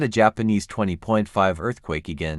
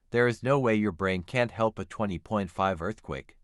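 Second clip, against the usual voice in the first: ordinary talk, nothing marked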